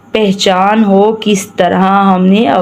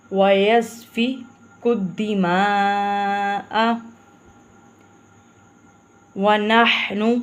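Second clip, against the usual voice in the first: slow recitation; 10 dB quieter, slower than usual. Shown as a demonstration. Teacher recites verse.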